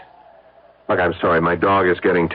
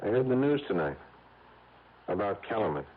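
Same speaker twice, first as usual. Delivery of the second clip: hushed on the phone; 7 dB quieter than usual. Acted. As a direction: keep it down, testing the other man. Quiet tense phone call.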